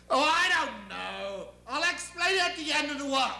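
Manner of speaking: airy, dismissive deity; high-handed almighty, impatient with questions; God. Acted. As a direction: brush the question off grandly. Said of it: "because I'm God".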